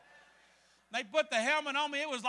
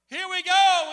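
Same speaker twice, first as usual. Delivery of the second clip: higher, loud imitated captain's call; loud launch call; 6 semitones higher, 13 dB louder, slower than usual. Captain launching the rafters, loud call. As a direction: call out to the whole crew.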